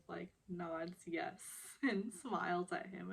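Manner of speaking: ordinary talk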